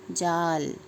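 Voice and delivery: जाल with a long, held aa; teacher's stretched जाल, vowel held; long aa demonstration. Shown as a demonstration. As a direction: draw out the आ in जाल. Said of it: "इसका उच्चारण भी देखो अलग हो रहा है".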